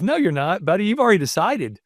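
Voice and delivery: ordinary talk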